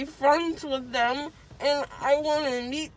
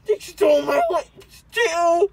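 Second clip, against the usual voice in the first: crying; 7 dB louder than usual. Real, not acted. Drugged child after dental surgery.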